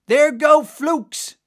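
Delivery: high, drawn-out lookout call; high-pitched cry to the whole crew; lookout's cry. A narrator's reading. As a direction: call the sighting out across the sea. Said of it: "was the cry".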